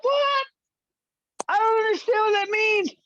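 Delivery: very high, fast, crying plea; whimpering beaten man. Pretend. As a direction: beg for mercy in a squeaky sob. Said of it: "he's like".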